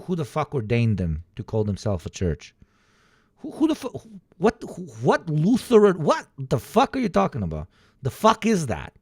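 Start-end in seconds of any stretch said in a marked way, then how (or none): none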